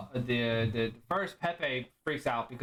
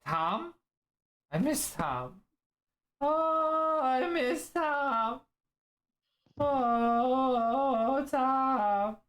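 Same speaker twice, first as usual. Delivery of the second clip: much higher, drawn-out longing moan; mournful Liger; 12 semitones higher, 3 dB louder, much slower than usual. Acted. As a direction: pine for a lost friend. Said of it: "tears start to run down".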